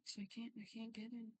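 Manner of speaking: breathy frustrated whisper. Real, not acted. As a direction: whisper it under your breath, annoyed. Muttering frustration at tech.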